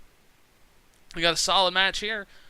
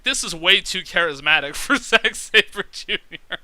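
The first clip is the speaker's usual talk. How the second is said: reads the comment out, laughing halfway through, louder; laughing while quoting; 4 semitones higher, 9 dB louder than usual. Retold or imitated. Quoting a viewer comment, laughing through it. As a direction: quote the comment and crack up on it. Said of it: "I got comments like".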